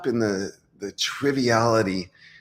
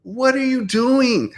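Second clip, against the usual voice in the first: exasperated challenge; 9 semitones higher, 5 dB louder than usual. Real, not acted. Challenging hypocritical woke clients, exasperated and louder. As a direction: demand an answer, incredulous.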